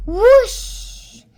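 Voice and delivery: high, loud whooshing sound effect; playful flying whoosh. Pretend. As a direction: blow out a big whoosh.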